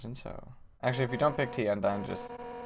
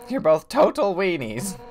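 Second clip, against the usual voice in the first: raised, punchy jeer; loud teasing insult; 6 semitones higher, 8 dB louder than usual. Acted. Host comparing guest to old mentor, loud teasing jeer. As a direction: tease them loudly in a higher pitch.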